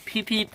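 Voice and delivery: soft, high peep-peep; imitated train whistle. A narrator's reading. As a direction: imitate a little engine's whistle. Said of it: "blew his whistle".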